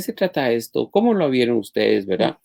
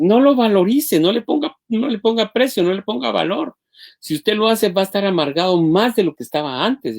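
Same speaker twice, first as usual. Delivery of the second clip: higher, pressing plea; urgent warning; 9 semitones higher than usual. Real, not acted. Counselor warning couples, pitched high and urgent. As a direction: urge them not to keep score.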